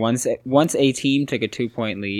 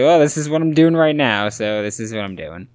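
loud, higher mock quote of the player; 4 semitones higher, 7 dB louder than usual. Host retelling the player's answer, loud and mocking. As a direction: blurt it out like a carefree athlete. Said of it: "he was just like".

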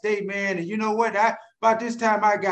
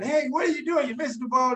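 retold hollering; 5 semitones higher than usual. Teammate scolding him for missing the ball, mock hollering. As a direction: imitate a teammate hollering in a raised pitch. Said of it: "he just started hollering".

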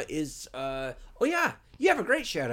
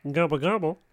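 lightly voiced Kermit gobble; a hint of a frog puppet gobbling; 4 semitones higher, 4 dB louder than usual. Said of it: "sound like Kermit the Frog".

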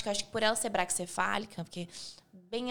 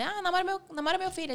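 higher-pitched pleading follower; imitated fan request; 8 semitones higher, faster than usual. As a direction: ask it in a higher voice, like a fan messaging. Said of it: "todo mundo manda". (imitando seguidor pedindo, voz mais aguda)

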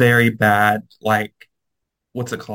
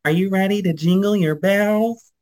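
higher-pitched quote of the video woman; imitating woman in video; 7 semitones higher than usual. Retelling the video to friend, imitated high voice. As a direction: lift it high, like her call to the crowd. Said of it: "And she's like".

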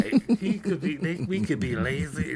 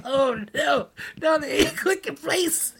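high, loud, slow mock whimper; hammed-up crying protest; mock wail; 13 semitones higher, 6 dB louder, slower than usual. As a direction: wail like panicked kitchen staff. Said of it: "They go like".

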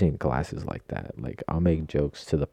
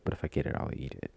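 hushed resigned shrug; whispered; 4 semitones lower, 6 dB quieter, faster than usual. Shown as a demonstration. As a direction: drop it to a whisper. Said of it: "repeated line".

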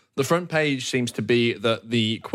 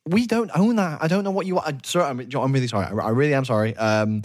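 stammering, higher-pitched pleading; meek receptionist; 3 semitones higher than usual. Acted. Front desk clerk placates a fuming guest, meek and flustered. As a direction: fumble through repeated apologies. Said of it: "a meek human male kind of standing there".

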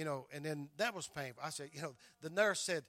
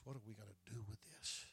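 the question again, much softer; quiet repeat; 6 semitones lower, 11 dB quieter than usual. Retold or imitated. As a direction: let it sink to a hushed echo.

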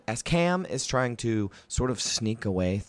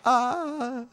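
much higher, eager stammering writer; Volo; 4 dB louder, slower than usual. Acted. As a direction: fuss and fluster like a pompous little chronicler. Volo again.

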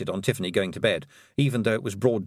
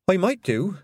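put-on higher, light girl voice; unsure young girl; 8 semitones higher, 3 dB louder than usual. Tiffany voice.

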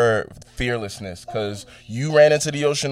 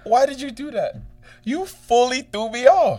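high, startled protest; surprised; 11 semitones higher than usual. Real, not acted. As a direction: thrown off and caught out. Caught off guard by cohost.

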